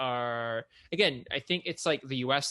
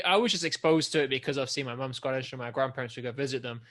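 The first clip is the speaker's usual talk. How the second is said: ordinary delivery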